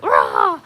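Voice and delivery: low, loud chasing growl; mock-scary growl; playful monster roar. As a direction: roar like you're chasing a dog. Imitates chasing the dog round table.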